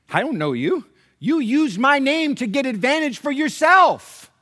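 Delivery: acted outrage, drawn out at the end; indignant divine rebuke. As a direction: denounce the faker as God in judgment. Acts God rejecting a false believer.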